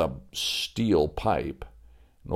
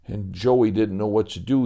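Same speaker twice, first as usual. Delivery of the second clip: ordinary delivery, nothing marked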